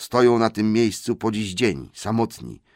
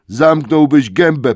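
slow, much louder, higher angry command; angry troll snap; 8 semitones higher, 11 dB louder, slower than usual. Acted. Two trolls angrily order Tom to shut up. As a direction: shut him up with force.

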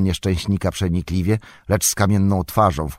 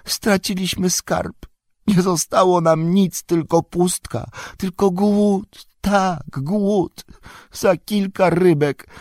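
Gollum's voice; 11 semitones higher, 3 dB louder than usual. A narrator's reading. Bound Gollum pleads innocence to Faramir, high and pitiful. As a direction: whine it like a wretched captured creature.

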